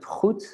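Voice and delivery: goed with a harder, throatier G at the start; harder initial G. Shown as a demonstration. Teacher contrasts word-initial G, harder throaty goed. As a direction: scrape the first sound of goed in the throat. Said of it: "then it's a bit harder".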